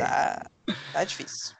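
breathy whisper, voice dropped away; whispered aside. Real, not acted. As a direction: whisper it to yourself, resigned. Repeats the remark as a whisper.

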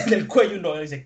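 ordinary delivery

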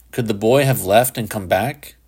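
ordinary delivery

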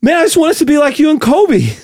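high, breathless admirer; Mark Wahlberg. Acted. Actor admiring Shaq, breathless Wahlberg voice. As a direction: plead winded in a lifted voice. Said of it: "You're gonna go Wahlberg".

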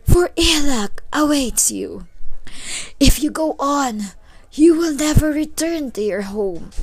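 put-on high, slow old woman's voice; ominous high-pitched warning. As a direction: foretell ill luck in a high, drawn-out voice. Old woman warns the hero.

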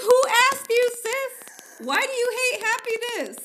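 high, loud mock outrage; shrill-free high mock indignation, loud; outraged internet. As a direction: squeal it like an offended fan. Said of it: "We're like".

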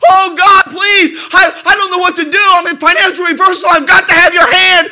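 loud, high shouted begging; desperate plea. Retold or imitated. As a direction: cry out to God in panic. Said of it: "that's exactly what it means".

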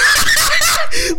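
high squealing laugh; shrieking laugh. Real, not acted. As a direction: burst into a shrill cackle. OnlyFans joke.

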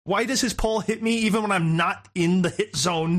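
high-pitched, whining frustration; exasperated gamer rant. Acted. Player complains to Hamish about the pole guy, exasperated. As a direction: rant at an unfair enemy, pitch jumping up. Acting out rage at game enemy.

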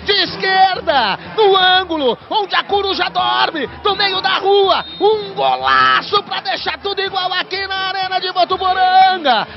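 high, slow ecstatic praise; explosive goal commentary. Real, not acted. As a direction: savour every word of the goal. Celebrating goal replay.

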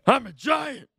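high-pitched, strained giant cry; hammed-up wrestling giant announcing himself; Big Show. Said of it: "do a Big Show impersonation".

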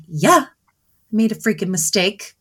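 mock agitated. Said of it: "instead of getting agitated".

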